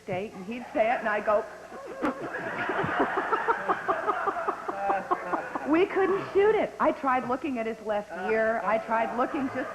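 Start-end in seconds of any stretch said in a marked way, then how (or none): 1.5-5.6 long high rising giggle; helpless giggles climbing up; giggling fit. Real, not acted. Recalling the scene, high helpless giggles. Cracking up at the line.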